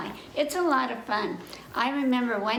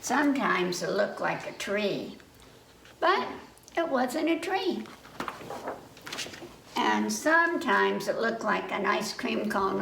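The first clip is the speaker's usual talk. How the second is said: put-on reading to children. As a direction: read it like story time for little kids. Reading a picture book aloud.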